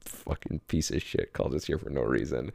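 low, quiet contemptuous grumble; low glaring mutter. Retold or imitated. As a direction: mutter it with a glare. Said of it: "glaring at him".

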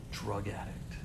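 a hushed, disgusted whisper. Real, not acted. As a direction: drop to a bare whisper. Whispered peak of abuse story.

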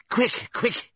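high, frantic donkey's cry; urgent shout. A narrator's reading. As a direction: call out in alarm, pitched very high. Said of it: "he shouted".

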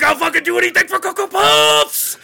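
loud, high frantic scream; intense cuckoo mascot. Acted. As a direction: scream like a cartoon bird desperate for cereal. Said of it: "He's too intense".

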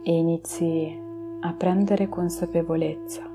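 slow, soft meditation voice; guided meditation. Acted. Leads a relaxation meditation.

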